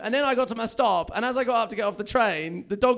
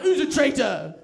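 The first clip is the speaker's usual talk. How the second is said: loud, raised pet-talk turning into accusation; special dog voice, livid; 4 semitones higher, 6 dB louder, slower than usual. Acted. Man to the dog that betrayed him, livid dog voice. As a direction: start cooing high, slow down and drop as it sours. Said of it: "still in the voice".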